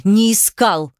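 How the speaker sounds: sharp, emphatic contradiction; sharper denial. A narrator's reading. Heroine repeats her denial to Demyan, sharper. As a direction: hammer the denial home sharply. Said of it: "Ещё резче".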